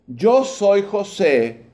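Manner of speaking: very slow, every word weighed out; Joseph's solemn, drawn-out self-reveal; slow dramatic reveal. Acted. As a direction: let the reveal land slowly. Joseph reveals himself to brothers.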